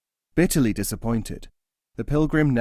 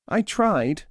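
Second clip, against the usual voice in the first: high plaintive bird voice; small and hurt; forlorn nightingale; 8 semitones higher than usual. Acted. First nightingale tells his friend, higher and plaintive.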